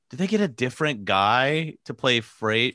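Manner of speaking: mock-bewildered, stretching the words out; baffled. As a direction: replay your disbelief at the screen. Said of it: "being like".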